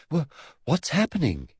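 high, breathless stammer; gasped question. Acted. Boy running to keep up asks, gasping. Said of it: "he gasped".

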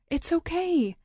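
high, bright reassurance; relieved reassurance. Acted. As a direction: comfort them with breathless relief. First reassurance, high and fast.

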